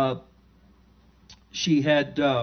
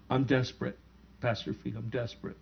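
pleading softly; 3 semitones lower, 8 dB quieter than usual. Retold or imitated. Daughter pleading for dying father.

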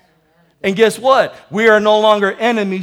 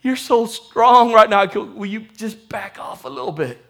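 quiet plea; 3 semitones lower, 7 dB quieter than usual. Retold or imitated. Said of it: "I would say".

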